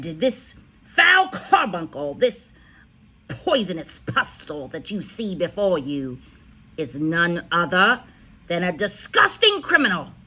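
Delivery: furious headmistress tirade; ranting insults. A narrator's reading. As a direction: spit each insult at him.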